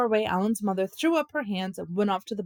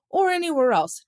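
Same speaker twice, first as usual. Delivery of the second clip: higher, louder emphatic warning; stern warning; 8 semitones higher, 5 dB louder than usual. A narrator's reading. Father warns his son at dinner, raised emphatic voice. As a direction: hammer home the rule.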